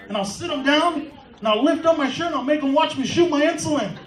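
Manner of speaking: usual speaking voice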